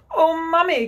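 put-on high, loud child's exclamation; child's cry. A narrator's reading. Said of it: "cried the first twin".

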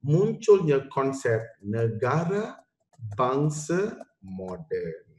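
slow and deliberate, each word weighted; slow key point. Shown as a demonstration. Teacher stressing the most important part to students, slow and deliberate. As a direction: spell out the key idea slowly for the class. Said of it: "here comes the most important part".

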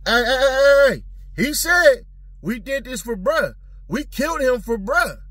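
high, loud hurried brag; caught on a phone call. Retold or imitated. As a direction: rattle it off like a careless caller.

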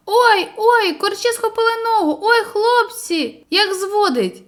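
high, loud pained yelps of cramp; a boy crying out in the water. Acted. Boy yells to friends about leg cramp, pained cry. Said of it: "Зненацька Журавель зойкнув".

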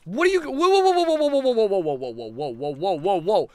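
rising panic, then settling; alarmed whoas. Real, not acted. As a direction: back away in sudden alarm. Ghost appears in game.

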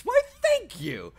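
high, slow, loud Southern belle "Why, thank you"; over-the-top Southern accent; mock Southern belle. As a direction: gush it like a hammed-up Southern lady. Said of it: "Your southern accent is atrocious".

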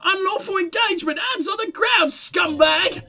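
high, shrill yelled commands; megaphone shout. Acted. Woman yelling orders at the cops through a megaphone.

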